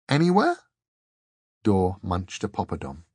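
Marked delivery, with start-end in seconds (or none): 0.1-0.6 surprised question. A narrator's reading.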